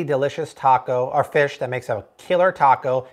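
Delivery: ordinary talk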